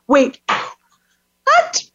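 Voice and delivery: disbelief. Retold or imitated. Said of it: "we're like".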